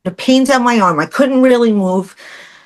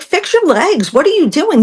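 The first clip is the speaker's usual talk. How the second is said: mocking husband; 9 semitones higher than usual. Mimicking impatient husband.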